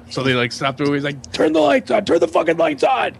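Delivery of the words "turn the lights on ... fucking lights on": high-pitched barked order; retold yelling. Host ordering guests to turn lights on, yelling. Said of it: "He's yelling".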